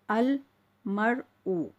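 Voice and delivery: "अल", pause, then "मर ऊ" drawn out; separated syllables. Shown as a demonstration. Teacher reading separately so learners understand, syllable by syllable. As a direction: read "अल मर ऊ" piece by piece. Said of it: "आपको समझाने के लिए बिल्कुल अलग-अलग करके पढ़ रही हूँ".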